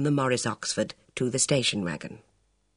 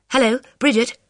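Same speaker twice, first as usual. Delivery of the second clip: put-on young woman voice; 10 semitones higher, 5 dB louder than usual. Elvira voiced on phone.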